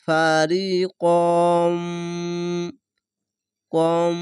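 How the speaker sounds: ফারিকম with the final m held long in a nasal hum; held final mim. Shown as a demonstration. Quran teacher showing the hold on mim, ফারিকম ending in a long held m. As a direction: draw out ফারিকম, holding the closing m. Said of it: "মিমকে ধরবে".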